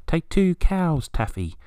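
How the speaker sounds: high, slow cooing sing-song; wood pigeon call. Retold or imitated. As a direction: mimic the five-note pigeon coo. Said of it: "sounds like the bird is saying".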